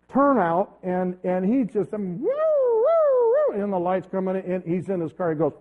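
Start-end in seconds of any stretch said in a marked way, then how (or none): 1.9-3.5 high, slow wailing woo-woo like a police siren; siren imitation. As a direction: pastor mimics the patrol car's siren, drawn-out wail.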